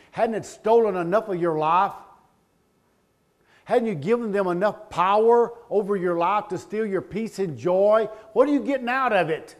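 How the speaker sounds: loud, high, driving sermon voice; preaching at full force. Acted. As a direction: build it up and hammer the congregation.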